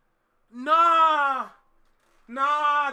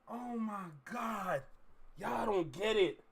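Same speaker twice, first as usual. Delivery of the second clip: hushed awe; 4 semitones lower, 9 dB quieter than usual. Real, not acted. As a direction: murmur it in stunned disbelief. Stunned after excited outburst.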